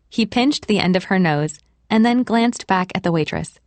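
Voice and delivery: ordinary delivery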